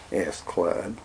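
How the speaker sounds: ordinary delivery, nothing marked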